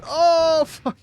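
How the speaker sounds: sudden loud Oh jumping way up; a startled cry; startled high yelp. Real, not acted. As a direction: yelp in shock as something hits. Sudden in-game setback.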